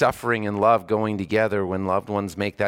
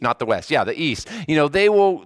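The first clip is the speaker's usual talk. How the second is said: ordinary talk, nothing marked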